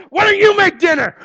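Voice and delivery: high mock shout; hammed-up exasperated yell; exasperated retort. Acting out snapping back about dinner.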